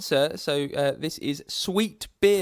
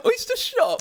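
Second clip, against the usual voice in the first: incredulous. Real, not acted. Mocking co-host's mistake.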